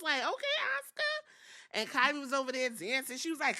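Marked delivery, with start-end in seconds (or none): 0.2-1.8 mock falsetto. Mocking Asuka's rolled R.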